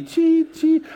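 high chirpy beeping noise; mock scanner chirp. Telling the congregation about security, a high mock beep. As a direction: mimic the machine's chirp. Mimicking airport scanner beeps.

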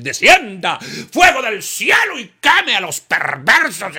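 loud, high, slow call for fire; a loud, high, slow shouted curse on the wicked; fiery shout. Retold or imitated. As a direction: thunder it like a vengeful prophet. Calling down fire.

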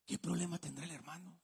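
imitating a nosy churchgoer, low and hushed; murmullo de chismoso. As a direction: wonder it softly under the breath, mock-curious. Imita al curioso que murmura. (murmullo bajo de curioso, imitado)

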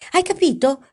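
angry shout. A narrator's reading. Boss checking that the woman understood, shouting angrily. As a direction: shout it as an order. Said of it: "gridò Paolo".

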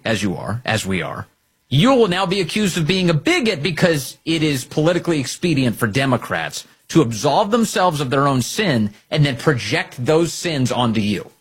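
ordinary talk

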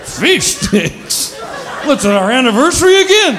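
hammed-up trashy rant; sarcastic husband. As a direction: scoff at fish sticks like a drunk husband. Ken sneers at dinner.

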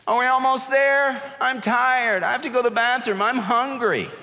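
put-on high, whining little kid complaints; whiny child. Preacher imitating kids whining on a long car trip, whiny. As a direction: pile on the kid's whining.

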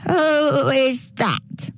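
high, slow, drawn-out mock granny; fake grandma voice. A narrator's reading. Dingo answers the door pretending to be grandma, fake granny voice. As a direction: pose as grandma, high and stretched out. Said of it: "trying to sound like a grandma".

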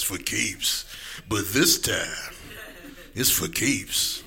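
low, slow trailer read of the line; low trailer take. Pretend. Mocking friend's phrase, epic trailer voice. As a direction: make it sound epic. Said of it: "movie trailer terms".

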